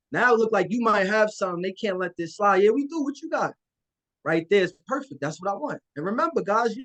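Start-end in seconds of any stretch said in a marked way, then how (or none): 2.6-3.5 eager buyer. Acted. Buyer voiced eager reply.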